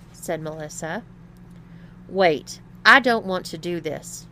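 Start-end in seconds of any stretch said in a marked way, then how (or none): 2.1-4.3 louder, urgent objection; protesting. A narrator's reading. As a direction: object firmly, voice raised. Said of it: "protested Tom".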